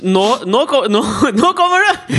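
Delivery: high, laughing mock cheer; mock cheer through laughter. Teasing the sisters, a giggling high mock cheer. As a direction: squeal it out while cracking up. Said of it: "Liksom sånn".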